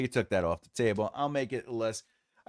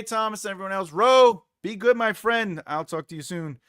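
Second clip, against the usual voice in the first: ordinary delivery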